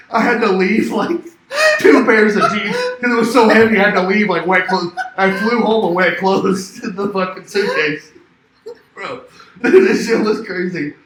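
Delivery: loud, high, cracking up through the words; helpless laughter breaking into the storytelling; laughing while talking. Real, not acted. Guest telling friend about wet laundry in his suitcase, laughing.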